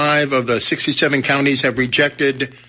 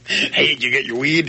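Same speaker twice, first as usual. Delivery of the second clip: stoner voice. Acted. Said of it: "They all talk like that in Missouri".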